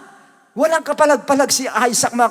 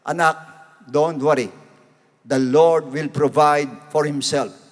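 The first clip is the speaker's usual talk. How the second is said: Abraham reassuring; 8 semitones lower, slower than usual. Retold or imitated. Preacher voices Abraham reassuring son.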